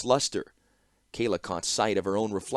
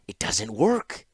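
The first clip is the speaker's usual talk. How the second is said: hissed; slower than usual. Acted. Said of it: "hissed the clockmaker".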